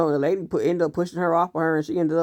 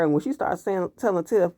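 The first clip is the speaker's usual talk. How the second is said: ordinary talk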